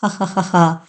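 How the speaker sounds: eerie sarcastic cackle in bursts; mocking laugh. Strange woman mocking Keerthan's question, eerie laugh. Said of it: "ఆమె వ్యంగ్యంగా నవ్వసాగింది".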